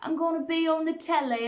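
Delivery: high-pitched imitation English accent; mock English accent. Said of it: "like, an English accent".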